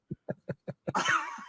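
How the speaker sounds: high, real burst of laughter. Real, not acted. Laughs at the anecdote.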